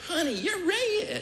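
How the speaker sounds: lightly hammed-up wife remark; mock wife. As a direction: play the wife, teasing and slow. Wife notices his face.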